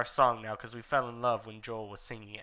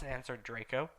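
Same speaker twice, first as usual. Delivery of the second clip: ordinary delivery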